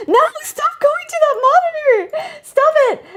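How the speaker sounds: very high exasperated squeal; high-pitched, fed-up protest; exasperated scolding. Real, not acted. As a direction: scold the misbehaving window in a high voice. Scolding window that jumps monitors.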